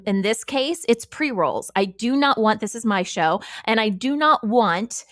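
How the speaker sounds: ordinary delivery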